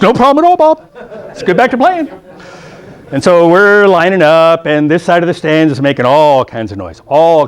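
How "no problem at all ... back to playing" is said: high, cheery mock coach voice; hammed-up cheerful coach; smiling coach. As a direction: sing it out like the happy winner. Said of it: "He goes".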